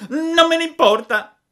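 very high, loud sulky retort; defiant puppet. A narrator's reading. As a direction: refuse loudly like a stubborn child. Defies warning of grave illness. (burattino testardo, strillo acuto)

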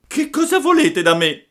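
very high, loud alarmed shriek; frightened cry. A narrator's reading. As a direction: cry out like a scared puppet. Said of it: "gridò Pinocchio, rizzandosi tutto impaurito". (grido acutissimo e impaurito)

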